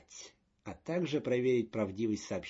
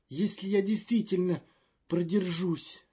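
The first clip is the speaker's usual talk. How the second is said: high, loud and fretful; a jittery official in a panic; nervous diplomat voice; 7 semitones higher, 6 dB louder than usual. Acted. Magnan doubtful.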